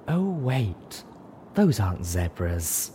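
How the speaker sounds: slow, offhand correction; casual afterthought. A narrator's reading. Unicorn finishes her jab at the zebra, casually. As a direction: drop it in casually, unhurried. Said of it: "Velvet casually finished".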